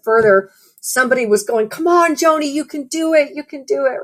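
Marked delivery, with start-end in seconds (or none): 1.7-4.0 high, encouraging call from the crowd; retold cheer. A voice from the crowd urging the tired runner, high and pushing. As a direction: urge the runner on, mock cheering. Someone cheering her on mid-race.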